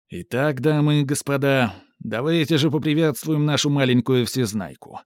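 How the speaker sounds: ringmaster announcement. Acted. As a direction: announce it to the audience. Сарацин объявляет публике. (объявление конферансье)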